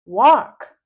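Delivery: a loud, firm command; sharp order. Acted. Mom dragging her daughter upstairs, loud order. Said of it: "she says".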